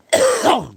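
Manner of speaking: sharp strained cough, real; hacking cough. Real, not acted. Streamer tells viewers he's chunking up tar, strained hacking cough. Smoker coughing after pipe.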